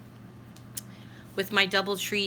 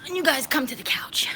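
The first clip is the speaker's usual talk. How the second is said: hushed aside to viewers; whispering; 5 semitones higher than usual. Real, not acted. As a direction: whisper it under your breath.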